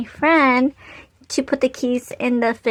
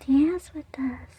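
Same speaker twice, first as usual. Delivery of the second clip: faint whispered invitation; whispering; 2 semitones higher, 7 dB quieter than usual. Retold or imitated. The ladies beckoning the narrator again, whispering. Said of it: "'cause the ladies was like".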